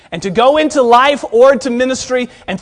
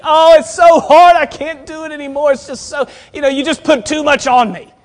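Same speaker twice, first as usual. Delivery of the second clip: complaining; 6 semitones higher, 4 dB louder than usual. Retold or imitated.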